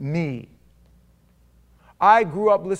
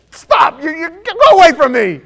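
much higher, much louder panicked shout; mock terrified cry; 13 semitones higher, 15 dB louder than usual. Preacher acting out a frightened plea, mock terrified shout. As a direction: cry out as if pushing someone away in fright.

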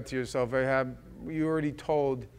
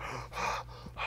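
sharp breathless gasp; frightened gasp; 11 dB quieter than usual. Acted. Ben-Hadad's man gasps after the escape, frightened gasp. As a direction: gasp in relief and fear.

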